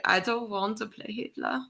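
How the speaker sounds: put-on soft, tentative German-accented line. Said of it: "Can you do a German accent".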